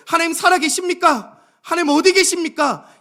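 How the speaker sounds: high, loud questioning; challenging cry to God. Acted. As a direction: demand an answer from God at full voice. Acted doubting cry to God. (하나님께 크게 따져 묻듯)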